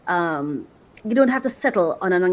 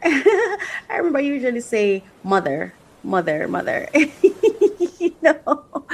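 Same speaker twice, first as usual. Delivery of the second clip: laughing; 7 semitones higher than usual. Real, not acted. Laughing at her word.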